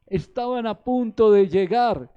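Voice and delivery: slow, high, booming proclamation; emphatic shout. Real, not acted. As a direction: shout it out slowly as the climax. Preacher's climax of the boat story.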